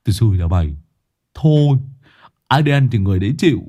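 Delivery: annoyed retort. Acted. Lâm Sẹo glaring back at Đồng Già, irritated and sharp. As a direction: snap back at the coward. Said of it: "Lâm Sẹo trợn mắt".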